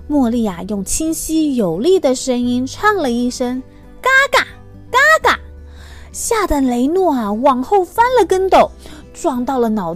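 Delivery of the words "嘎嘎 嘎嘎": high, loud honking call; loud goose honk. Acted. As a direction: honk it out clear and strong.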